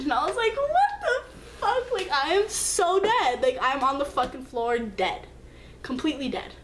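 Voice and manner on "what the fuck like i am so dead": high, slow, laughing-crying "what the fuck"; high laugh-cry disbelief. Real, not acted. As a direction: crack up in shocked disbelief. Retelling his casual answer.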